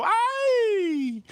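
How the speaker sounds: very high, drawn-out cry; cartel men's yell. Retold or imitated. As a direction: imitate a masked gang's high-pitched holler. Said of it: "then they take off their masks, go".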